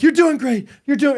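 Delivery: high, pushed cheering on; urgent encouragement. Retold or imitated. Husband cheering his labouring wife, high and urgent. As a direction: pump her up, fast. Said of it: "and I'm like".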